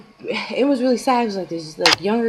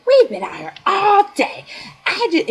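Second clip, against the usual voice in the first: mock angry rant, much higher and louder; going off; 13 semitones higher, 5 dB louder than usual. Friend rants at the store on the phone, higher mock anger. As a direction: imitate her ranting down the phone. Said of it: "goes off on them".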